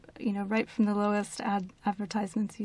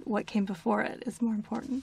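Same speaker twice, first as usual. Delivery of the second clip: ordinary talk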